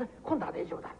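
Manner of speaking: soft whisper to himself; hushed whisper, almost to himself; whispered hope. Acted. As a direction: whisper it, hoping it works this time.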